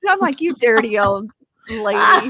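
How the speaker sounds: tickled, giggly retelling; laughing. Real, not acted. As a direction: laugh through a mock scolding. Laughing over granny's cheeky retort.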